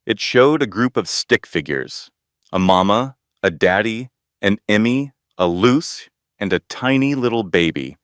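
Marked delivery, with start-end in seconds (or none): none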